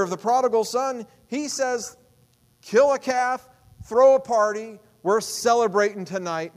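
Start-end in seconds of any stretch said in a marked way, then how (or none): 2.6-6.6 joyful father. Acted. Father voiced ordering a feast.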